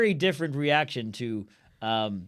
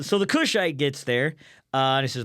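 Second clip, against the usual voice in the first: ordinary talk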